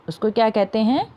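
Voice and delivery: normal talking voice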